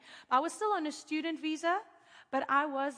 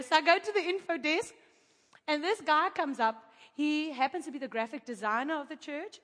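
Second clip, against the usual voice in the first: ordinary talk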